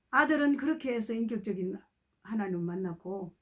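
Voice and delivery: ordinary delivery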